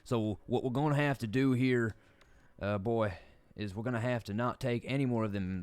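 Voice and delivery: lightly drawled Southern coach pep talk; Southern coach accent. Pretend. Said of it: "stream with this accent".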